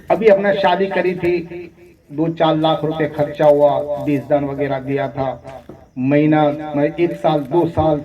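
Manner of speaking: usual speaking voice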